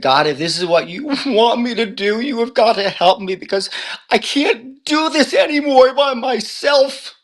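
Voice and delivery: desperate plea. Retold or imitated. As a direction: beg God for help, voice climbing until it breaks. Retold desperate prayer to God.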